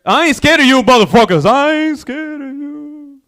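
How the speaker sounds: hammed-up defiant comic yell; Bernie Mac. Comedian doing an impression for the crowd, defiant shouting. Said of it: "You guys wanna see Bernie Mac?".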